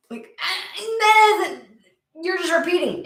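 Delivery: high, strained complaint; a high frustrated whine; exasperated whine. Real, not acted. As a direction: plead in frustration at the cards. Annoyed that spirit repeats itself.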